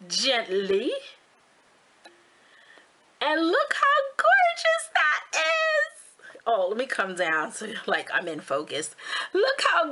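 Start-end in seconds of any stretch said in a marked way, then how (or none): 3.2-6.0 high, drawn-out squeal of delight; excited reveal. Real, not acted. Vlogger shows viewers the finished marbled ornament, excited gushing. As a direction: gush slowly, pitched way up.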